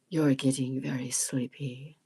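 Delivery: low, slow hypnotic drone; slow hypnotist voice. Shown as a demonstration. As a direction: lull them to sleep like a stage hypnotist. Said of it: "This is my hypnotic voice".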